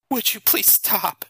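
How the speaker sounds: crying plea. A narrator's reading. Allison begs Nathan to stop, forceful and tearful. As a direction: plead harder, on the verge of tears. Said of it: "Allison cried, more forcibly this time".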